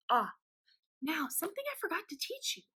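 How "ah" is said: "ah" as a short o, as in "hot"; one short vowel on "ah"; short O sound. Shown as a demonstration. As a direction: model "ah" as the sound of O. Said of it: "which says".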